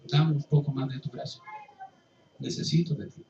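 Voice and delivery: más grave y suave, rogando; súplica humilde. Acted. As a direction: pide como mendigo, bajito. Oración del mendigo a Dios. (el pecador suplica gracia a Dios, suave)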